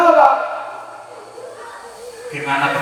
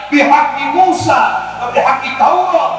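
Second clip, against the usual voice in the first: shout; 6 semitones higher than usual. Retold or imitated. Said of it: "dia teriak".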